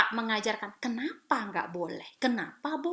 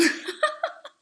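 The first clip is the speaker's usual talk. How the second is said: high, airy burst of laughter. Real, not acted. Speaker amused by her own story, high laugh. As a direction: laugh at your own admission.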